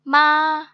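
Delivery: first-tone मा, steady with no rise or dip; flat first tone. Shown as a demonstration. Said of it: "बिल्कुल straight".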